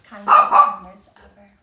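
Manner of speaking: high loud joy; delighted. A narrator's reading. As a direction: burst out the happy ending. Happy ending.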